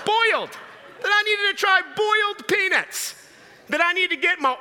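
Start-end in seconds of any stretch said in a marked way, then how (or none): 0.1-3.1 BOILED high, with a full "oy" sound; clearly pronounced boiled. Shown as a demonstration. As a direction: exaggerate the "oy" in "boiled", pitched high. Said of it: "She was saying boiled peanuts".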